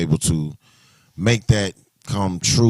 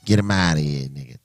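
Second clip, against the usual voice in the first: tough New York accent; 4 semitones lower, 4 dB quieter than usual. Shown as a demonstration. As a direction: throw it out New York style. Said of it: "in my New York accent".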